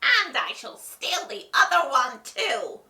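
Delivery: loud, high gloating witch voice; cackling witch. A narrator's reading. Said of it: "cackled the witch".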